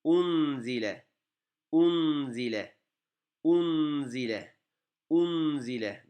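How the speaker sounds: "Unzile" drawn out slowly, the n held firm; slow model reading. Shown as a demonstration. Teacher slowly modelling the word for students. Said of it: "Dikkat edelim".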